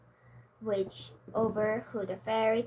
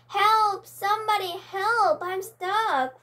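high, loud panicked yelling; trapped dragon shouting for rescue; cries for help; 9 semitones higher, 7 dB louder, slower than usual. Acted. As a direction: scream for help from behind a locked door. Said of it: "cried the magic dragon".

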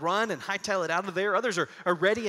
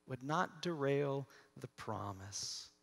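ordinary talk

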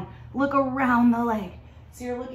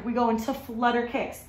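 normal talking voice

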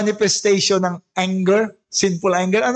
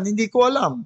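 normal talking voice

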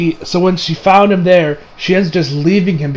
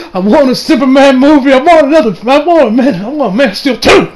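whiny pleading; 6 semitones higher than usual. Retold or imitated. Pleading for sequel.

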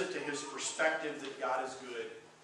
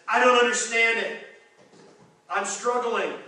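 loud, raised-pitch cry of confusion; exasperated, emphatic confession; frustrated; 5 semitones higher, 9 dB louder than usual. Acted. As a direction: voice frustration forcefully. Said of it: "he shares his frustration".

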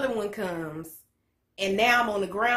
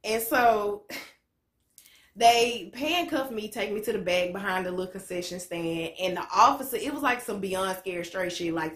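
ordinary delivery

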